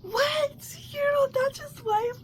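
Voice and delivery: high shocked disbelief; retold shock, pitched high; shocked what. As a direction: squeak out the disbelief. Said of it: "I'm like".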